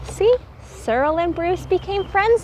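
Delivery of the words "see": usual speaking voice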